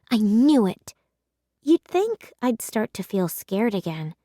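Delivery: ordinary delivery, nothing marked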